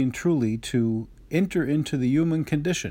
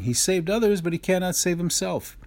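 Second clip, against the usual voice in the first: higher, sneering mockery of the crowd; mocking; 6 semitones higher than usual. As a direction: jeer at him like a scoffing scribe. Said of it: "the scribes and Pharisees mock him".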